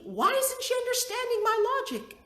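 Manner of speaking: high, exasperated mom's inner question; frustrated thought. Retold or imitated. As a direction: pitch it up in exasperation. Mother's imagined frustrated thought.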